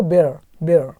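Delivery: बियर, बियर said low and clearly as one syllable; बियर said low and carefully; pronunciation demo. Shown as a demonstration. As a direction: model the word बियर for learners. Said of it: "बियर बोलते हैं इसको".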